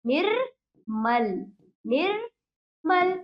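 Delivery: निर्मल very slowly as "nirr... mal", with a gap; split syllables. Shown as a demonstration. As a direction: break निर्मल after a held र. Teacher sounds out the correct word.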